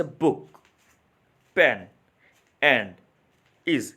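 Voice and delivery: बुक, पेन, and, is each in one falling beat; one-syllable words. Shown as a demonstration. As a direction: list बुक, पेन, and, is with a pause after each unbroken word. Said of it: "जो ये मैं pronounce कर रहा हूँ, ये मेरा एक ही sound". (हर शब्द एक ही साउंड में, रुक-रुककर)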